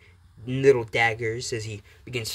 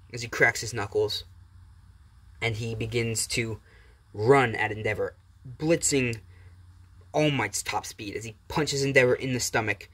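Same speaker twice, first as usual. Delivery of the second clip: usual speaking voice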